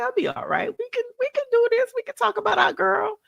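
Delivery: mock cheerful self-assurance; imitating her past self. Recalling her confident past self, mock upbeat and high. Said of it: "I thought that I was like".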